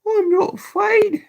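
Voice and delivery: put-on high child's voice, not low as the text says; a small boy bracing himself; young Oliver claiming he's not afraid; boy's voice. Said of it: "said Oliver in a low voice".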